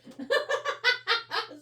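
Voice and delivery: high-pitched relieved giggle. Real, not acted. Found the glue.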